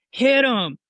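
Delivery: lightly voiced coach's shout; quoting the coach's ringside yell; Pops' coaching call. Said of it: "That's one thing Pops likes to say".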